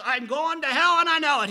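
high and loud, despairing; retold bitter anger. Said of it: "I am angry".

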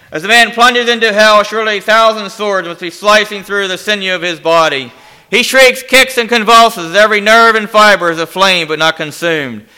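loud, urgent storytelling from the pulpit; impassioned sermon narration. Acted. Preacher telling of a man's plunge into hell, impassioned. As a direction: drive the horror home forcefully.

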